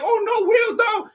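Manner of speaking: hammed-up frightened wife begging; mock alarmed plea. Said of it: "She wasn't like".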